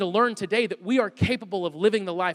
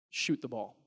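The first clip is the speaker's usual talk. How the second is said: much lower, softer, firm command; lowered dad voice; 8 semitones lower, 9 dB quieter, slower than usual. Retold or imitated. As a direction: drop your voice like a dad who won't yell. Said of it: "you actually lower your voice".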